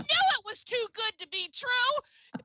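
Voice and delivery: high, loud gasp of surprise; gasp, then exclaim high in playful disbelief; shocked disbelief. Real, not acted. Host learning the accent is English, high shocked exclamation.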